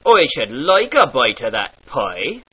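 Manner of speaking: "pie" said as "poi"; pirate oi. Shown as a demonstration. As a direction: turn the I of "pie" into oi. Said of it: "becomes an oi sound".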